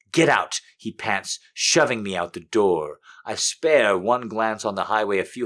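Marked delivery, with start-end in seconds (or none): 0.1-0.6 sharp, loud command; urgent shout. Acted. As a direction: bark it out urgently. Urgent cry, repeated louder.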